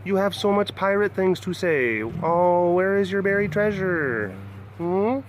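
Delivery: sing-song pet talk, praising her pirate looks. Real, not acted. Baby talk to his cat.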